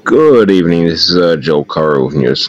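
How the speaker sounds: put-on official caller in an American accent; formal FBI agent, put-on American accent.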